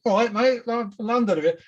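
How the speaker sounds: cheery London Cockney greeting, played up; Cockney accent. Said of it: "a young kid with a Cockney accent".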